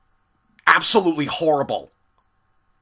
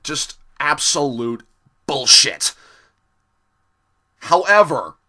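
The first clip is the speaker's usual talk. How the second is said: ordinary talk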